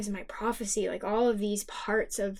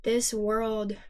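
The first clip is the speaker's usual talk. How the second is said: ordinary talk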